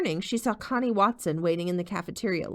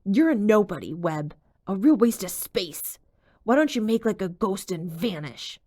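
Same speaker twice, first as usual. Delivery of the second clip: angrier; 5 dB louder than usual. A narrator's reading. Jake tormenting Webb downstairs, angry and cold. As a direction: spit the insult out. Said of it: "The voice grew angrier".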